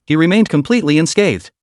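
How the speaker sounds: plain reading voice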